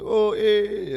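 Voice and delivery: high, loud "Oh, eh" greeting grunt; comedian greeting grunt. Retold or imitated. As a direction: toss out a knowing "Oh, eh". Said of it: "that's how stand-up comedians talk to each other".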